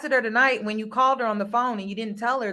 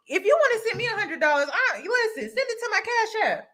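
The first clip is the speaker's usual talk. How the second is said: laughing, much higher, teasing him; playful laughing tease; 10 semitones higher than usual. Real, not acted. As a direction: toss it back with a laugh, voice up high. Teasing the host about money.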